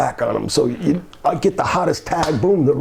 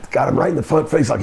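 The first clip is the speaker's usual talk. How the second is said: ordinary talk, nothing marked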